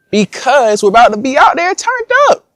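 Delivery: high, rising hype yell; pumped-up, high-pitched boast; hyped up. Real, not acted. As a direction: crow it excitedly, pitch climbing to the end. Hyped answer to the mock complaint.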